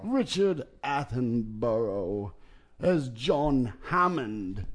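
put-on slow, teetering old British gentleman; lightly voiced wobbly old Brit; wobbly elder British voice. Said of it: "the wobbly elder British voice".

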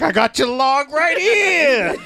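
slow New Yorker voice; hammy New Yorker delivery; New York accent. Retold or imitated. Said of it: "like, New Yorker".

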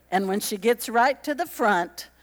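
ordinary delivery

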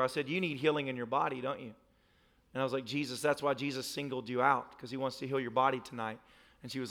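low, soft, earnest storytelling; solemn testimony. Real, not acted. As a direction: drop into a hushed, sincere account. Hushed solemn testimony after comedy.

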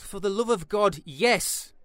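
loud, slow, emphatic exasperation; exasperated outburst. Acted. Interviewer begging hooligan for gory details, exasperated. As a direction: burst out at the end of your tether.

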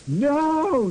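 long, high mock cry of no; hammed-up yell from a story. A narrator's reading. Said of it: "yielded everyone".

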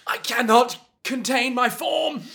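high, loud, dramatic cry; dramatic bird voice. Retold or imitated. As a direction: wail it like a trapped creature. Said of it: "the bird's all like".

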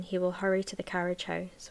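plain reading voice

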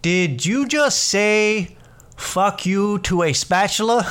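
high, slow, mock-incredulous question; puzzled disbelief. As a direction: imitate a baffled coworker working it out. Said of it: "he's trying to figure it out".

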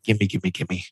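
soft, playful whispered begging; greedy whisper. Pretend. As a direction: whisper it like an eager mock plea. Playfully begging to see more.

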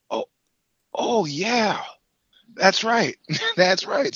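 high, loud, drawn-out agreement; retold sudden realization. Podcaster replaying his reaction to the drummer, high and emphatic.

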